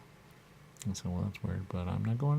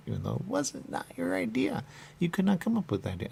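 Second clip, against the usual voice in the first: high mocking quote; 12 semitones higher than usual. Mocking quote set against the listener.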